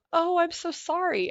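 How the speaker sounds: high, slow, syrupy "so sorry"; mock pity. Imitating friends pitying her hysterectomy, slow mock sympathy. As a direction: imitate their drawn-out condolence. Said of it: "I had everybody go".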